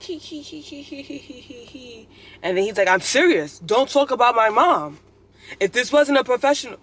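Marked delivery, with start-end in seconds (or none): 0.0-2.1 high, soft, fading hee-hee giggle; imitated snickering. Mimicking classmates laughing at the joke, mock snickering. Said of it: "And then everyone's like". 2.9-6.8 louder, higher, emphatic mock protest; imitating angry classmate. Student confronting the professor about his mom, mock indignation. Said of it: "I'm trying to imitate his voice".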